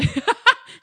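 loud, high burst of laughter; sudden loud high cackle; way too loud laugh. Real, not acted. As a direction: explode into a shrieking laugh. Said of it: "that was way too loud a laugh".

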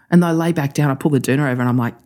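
ordinary talk

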